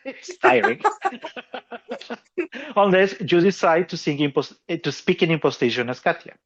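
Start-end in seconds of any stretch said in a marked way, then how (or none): 0.0-2.8 high, laughing delivery. Real, not acted. Singer joking to listeners about tiring speech, laughing. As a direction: let laughter break through the words. Laughs at his own demonstration.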